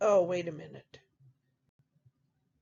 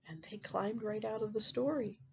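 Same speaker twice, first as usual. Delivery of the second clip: ordinary delivery, nothing marked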